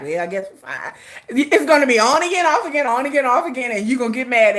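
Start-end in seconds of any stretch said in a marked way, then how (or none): 0.0-0.9 lower, softer reluctant forgiveness; soft giving in. Retold or imitated. Forgiving Yoongi again, a soft reluctant sigh of a line. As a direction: melt and forgive him.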